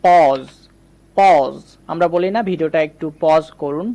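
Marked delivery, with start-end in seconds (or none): 0.0-1.5 "পজ" twice, loud, with a drawn-out "aw"; teacher modelling "পজ" firmly as "pawz"; long vowel demonstration. Shown as a demonstration. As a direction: stretch the "অ" in "পজ", pausing between repeats. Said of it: "যদি বলা হয়".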